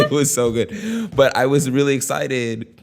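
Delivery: ordinary talk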